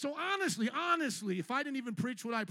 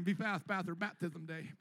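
mock impatient congregant; 4 semitones lower, 5 dB quieter than usual. Mimicking congregant urging speed.

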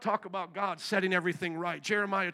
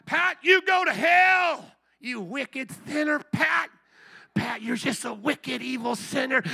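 damn them with hammed-up wrath; angry condemnation; 4 dB louder than usual. Preacher imitating the wicked cursing Pat, mock angry. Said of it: "what the wicked say to us when they're angry with us".

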